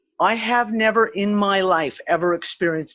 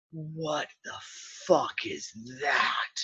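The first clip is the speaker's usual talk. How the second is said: hushed, slow disbelief; aghast; 3 semitones lower, 9 dB quieter, much slower than usual. Retold or imitated. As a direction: stretch each word out, barely voiced. Retold vertigo, gripping the car.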